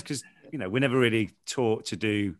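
normal talking voice

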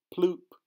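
high, sudden "ploop"; bobber plop. A narrator's reading. Bobber hitting the water, high plopping noise. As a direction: pop it out high and loud like a splash. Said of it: "The bobber makes it sound".

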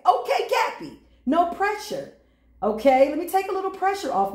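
high playful okay Cappy; playful high teasing voice to Cappy. Pretend. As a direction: tease it high and light. Teasing the card reader's self.

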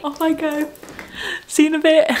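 bright high farewell; cheery sign-off. Real, not acted. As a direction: chirp it out as you leave.

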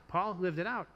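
normal talking voice

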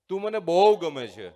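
loud call, stress on બહુ. A narrator's reading. Father shouting kindly into the valley, loud call. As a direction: call it warmly across the gorge.

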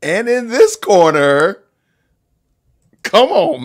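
ring announcer. Acted. Announcing a fight.

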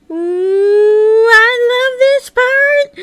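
high, loud, drawn-out squeal of delight; giddy excitement. Acted. Onlooker thrilled that the fusion is coming, giddy. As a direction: squeal it slowly in gleeful anticipation.

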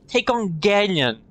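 ordinary talk, nothing marked

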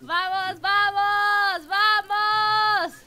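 high, loud drawn-out cheering; urging cheer. Real, not acted. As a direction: cheer the racers on loudly. Host urging on racing players.

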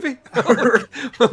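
normal talking voice

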